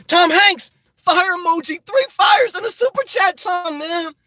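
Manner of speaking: high-pitched, hyped-up squeal of thanks; gleeful falsetto cheer for a donor; excited shout-out. Real, not acted. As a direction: hype up the super chat in a high voice. Hyping a viewer's super chat.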